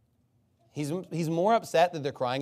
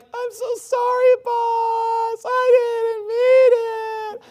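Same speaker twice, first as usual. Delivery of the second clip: very high, drawn-out fake bawling; hammed-up blubbering crybaby; mock whining and crying; 7 dB louder, much slower than usual. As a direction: beg the boss in a slow, loud wail. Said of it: "by the whining and crying".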